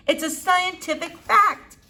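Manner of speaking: high, slow called-out warning; shouting while running. Acted. Flora shouts to her father while running, high and loud. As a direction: yell it back while running. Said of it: "Flora shouted as she ran".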